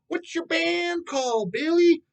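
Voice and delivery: high, slow, doting grandma voice; sweet old Nana. Retold or imitated. Grandmother asking her grandson about his band, high doting voice. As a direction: coo it like a kindly grandmother. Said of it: "Nana asked you something".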